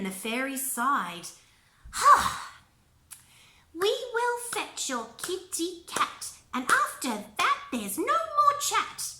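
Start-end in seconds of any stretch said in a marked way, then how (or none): 1.9-2.5 high loud exasperated "Ha!"; fairy exclamation. Acted. A weary fairy answering Nessa, loud high "Ha!". 3.7-9.1 fairy voice. Acted. Fairy telling Nessa to stop chatting, high sing-song.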